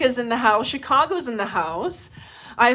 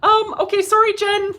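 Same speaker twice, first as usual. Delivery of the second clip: sheepish apology; 10 semitones higher, 6 dB louder than usual. Retold or imitated. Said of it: "I was like".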